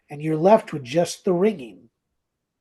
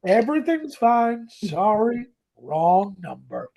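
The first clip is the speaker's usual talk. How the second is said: higher, lilting mock reassurance; put-on soothing apology, pitched up; George's voice; 5 semitones higher, 4 dB louder than usual. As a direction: imitate a good decent guy smoothing things over. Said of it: "that voice that says".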